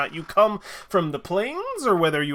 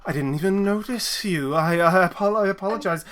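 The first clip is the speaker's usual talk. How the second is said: gushing apology; 5 semitones higher than usual. Acted. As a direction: stretch out the apology, pitched up. Wife apologises profusely.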